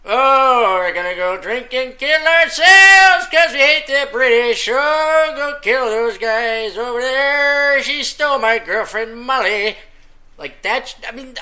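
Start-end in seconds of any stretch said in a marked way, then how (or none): 0.1-9.8 put-on loud, higher Irish-accented lament; exaggerated Irish pub-song voice; mock Irish ballad. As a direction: ham up a drunken Irish singer. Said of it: "Or Irish music".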